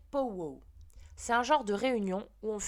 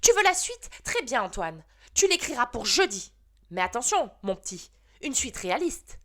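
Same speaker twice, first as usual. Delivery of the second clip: teacher exploding; 3 semitones higher, 8 dB louder than usual. Acted. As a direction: blow up and hand out the punishment. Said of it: "madame Balin a explosé".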